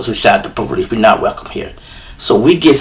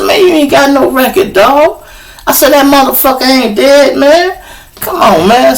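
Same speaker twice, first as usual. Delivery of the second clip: high-pitched, urgent pep talk to a friend; retold pleading, pitched way up; insistent reassurance; 13 semitones higher than usual. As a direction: talk him down in a raised, disbelieving voice. Talking panicked friend down, retold.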